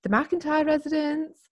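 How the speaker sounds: high, formal phone announcement; answering family phone. Retold or imitated. Imitating friend answering parents' phone, high and formal. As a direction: announce the household as if picking up. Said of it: "have to say things like".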